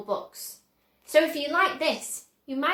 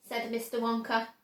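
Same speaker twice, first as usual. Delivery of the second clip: plain reading voice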